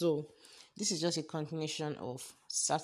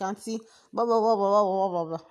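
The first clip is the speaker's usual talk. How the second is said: loud, raised gibberish mimicking a scolding; mocking loud jabber; 6 semitones higher, 11 dB louder than usual. Co-workers ambushing her about makeup, mocking loud jabber. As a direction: babble over her noisily, mockingly.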